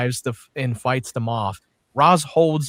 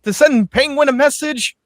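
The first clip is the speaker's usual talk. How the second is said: mock eager pitch, high-voiced; writer pitching an idea; 10 semitones higher, 4 dB louder than usual. Co-host mimicking the writers' room pitch, mock enthusiastic. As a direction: imitate someone selling a silly idea, pitched up. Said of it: "they're pitching that idea".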